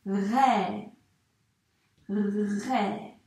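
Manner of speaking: RÉ with a long R and held vowel; teacher's drawn-out RÉ; sounding out RÉ. Shown as a demonstration. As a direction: stretch both sounds of RÉ. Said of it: "il faut bien dire tous les sons".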